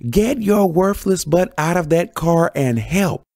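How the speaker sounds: high, slow, drawn-out angry holler; strained high-pitched scolding yell; bellowing. A narrator's reading. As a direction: bellow an order at a lazy husband. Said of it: "Joyce bellowed at Buddy".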